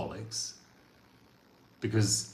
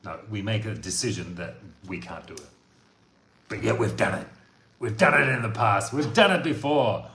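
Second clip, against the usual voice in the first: ordinary talk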